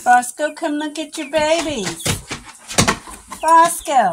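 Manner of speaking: sing-song calling a pet; calling the dog. Real, not acted. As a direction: call him over, bright and loud.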